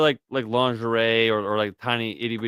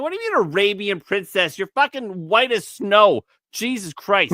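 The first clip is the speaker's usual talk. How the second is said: incredulous scoff; 9 semitones higher, 5 dB louder than usual. Retold or imitated.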